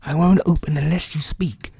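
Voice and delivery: put-on a plucky boy refusing to open; high, insistent young Peter; boy crying out. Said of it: "Peter cried".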